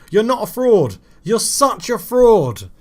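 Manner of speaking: loud, high, dragged-out insult; drawn-out exasperated shout at the striker; frustrated yell. Real, not acted. Angry at his striker after a missed chance, yelling.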